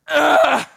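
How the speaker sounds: frustrated groan. Acted. Someone lost for what to pray, groaning loudly to God. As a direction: groan helplessly, as if out of words. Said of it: "but I'm just like".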